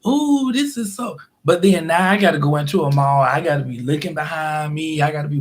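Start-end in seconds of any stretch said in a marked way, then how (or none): 0.0-1.2 high, drawn-out dreamy admiration; mock admiring shopper. Shopper in the mall imagined, gushing slowly and high. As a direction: gush over the mall like a carefree shopper. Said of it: "and be like".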